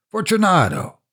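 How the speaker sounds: calling out. Acted. Narrator calling through the wall to his victim, impatient shout. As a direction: call his name into the dark, impatient. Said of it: "I called again".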